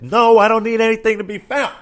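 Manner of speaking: exasperated outburst at the helpful clerk; indignant retort. Retold or imitated. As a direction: fire it back, fed up. Snapping back at store employee.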